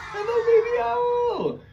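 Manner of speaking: very high, slow sung hello; Baby Owl squeal. Acted. Teacher greets Baby Owl for the kids, tiny high squeal. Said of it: "say hello to Baby Owl".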